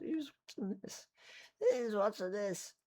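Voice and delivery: squeaky mock voice; high silly voice. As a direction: pitch it way up, jokey. Self-deprecating aside to viewers.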